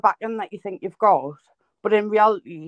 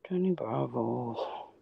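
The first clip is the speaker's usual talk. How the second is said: much lower, very slow, soft cartoon hunk; Johnny Bravo voice; 9 semitones lower, 11 dB quieter, much slower than usual. Streamer doing the cartoon voice his friend wouldn't do, deep and slow. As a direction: drawl it out deep like a lunk admiring himself. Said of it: "Can you do the Johnny Bravo voice?".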